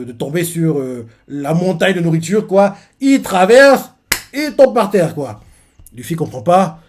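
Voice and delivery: ordinary talk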